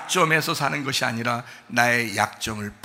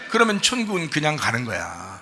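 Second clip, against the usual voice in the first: ordinary talk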